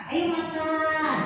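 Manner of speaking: slow, drawn-out gentle "Ayo makan"; softened downward tone. Shown as a demonstration. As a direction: coax "Ayo makan" slowly, without snapping. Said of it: "Hmm, ke bawah". ("Ayo makan" pelan, lembut mengajak)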